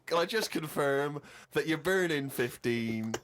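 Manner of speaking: ordinary talk, nothing marked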